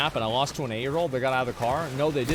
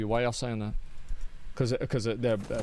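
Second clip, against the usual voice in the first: ordinary delivery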